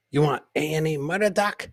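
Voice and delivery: ordinary delivery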